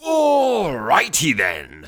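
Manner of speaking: hugely drawn-out, swooping put-on voice; hammed-up, elongated announcement; comic catchphrase. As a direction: stretch it out and slide down.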